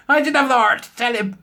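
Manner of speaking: high, laugh-filled words. Real, not acted. Telling viewers about his daft friend, laughing high. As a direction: laugh through the line.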